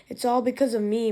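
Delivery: usual speaking voice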